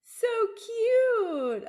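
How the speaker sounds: squealed high and loud, delighted; gushing. Real, not acted. Host gushing at guest's baby photo, high delighted squeal.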